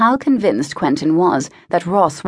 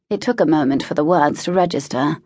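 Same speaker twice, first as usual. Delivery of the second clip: ordinary delivery, nothing marked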